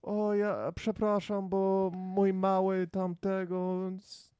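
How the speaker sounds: high, slow mock apology in Polish; imitated Polish apology. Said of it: "tell me how he said it in Polish".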